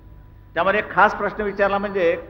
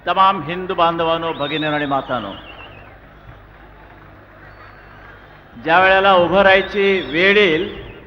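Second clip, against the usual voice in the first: loud, booming address to the crowd; rally speech; 5 dB louder than usual. Real, not acted. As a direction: rouse the crowd from the podium. (जोरदार सभेचे भाषण)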